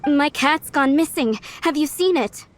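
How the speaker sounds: normal talking voice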